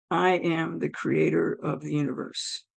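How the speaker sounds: low, measured, solemn pronouncement; voice of God. Retold or imitated. God to the newly dead woman, solemn and low. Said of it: "And I hear".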